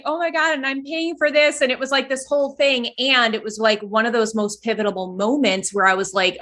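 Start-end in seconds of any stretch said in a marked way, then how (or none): 0.0-1.6 higher, half-laughing disbelief; a mock gasp at paying for it; mock dismay. Retelling her shock at paying the coach, mock dismay.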